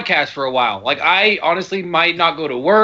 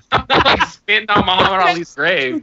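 laughing; 6 semitones higher than usual. Real, not acted. Defending himself, laughing.

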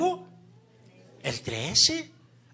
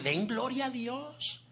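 retold preaching to them; 9 semitones higher than usual. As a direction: replay your own words to the household. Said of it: "y yo literalmente les dije".

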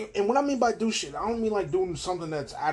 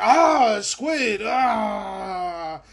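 higher, louder strained shouting; Kong battle yell; 6 semitones higher, 7 dB louder, much slower than usual. Retold or imitated. Kong yelling as he fights the squid, mock shout. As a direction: roar it like a fighting ape.

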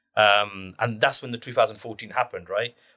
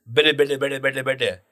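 lightly imitated mockery of a foreign language; mocking gibberish; 2 semitones higher, slower than usual. Man behind mimicking someone's Bengali phone call, mocking gibberish. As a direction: rattle off mock foreign syllables. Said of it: "He just kept repeating it".

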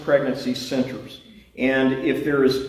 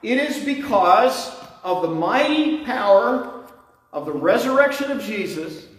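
high, slow, fervent sermon delivery; preaching; 6 semitones higher than usual. Acted. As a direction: preach each phrase with building fervour. Preaching at full force.

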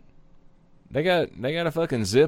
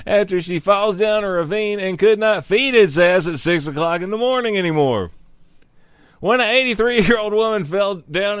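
news read; 4 semitones higher than usual. Acted. Reading news article aloud.

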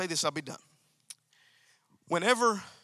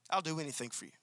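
much softer, hushed devoted promise; tender devotion; 5 semitones lower, 11 dB quieter, faster than usual. Shown as a demonstration. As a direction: breathe it to her as a tender vow. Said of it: "with an attitude that says".

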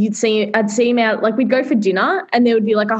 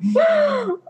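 gasp. Real, not acted. Shocked at yoga news.

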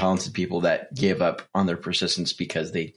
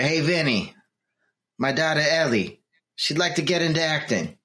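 higher-pitched, badly faked Italian mobster; terrible Italian accent; 5 semitones higher than usual. Mobster asking Vinny to get daughter into acting, bad Italian accent. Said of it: "my Italian accent is terrible".

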